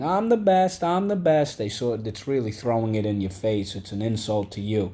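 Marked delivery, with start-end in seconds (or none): 0.0-1.6 high, smug singsong bragging; mocking a braggart; boastful bragging. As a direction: brag like a show-off kid. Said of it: "when they tell everyone how well they do something".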